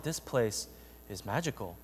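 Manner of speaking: put-on soft, low childlike wonder; hushed awe. Boy seeing the mall at last, hushed awe. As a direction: say it softly, in awe of the place.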